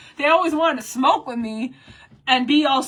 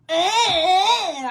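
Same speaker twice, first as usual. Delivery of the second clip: choked cry; 6 semitones higher, slower than usual. Real, not acted. Choked up mid-rant.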